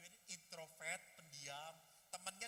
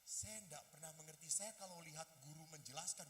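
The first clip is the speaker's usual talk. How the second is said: ordinary delivery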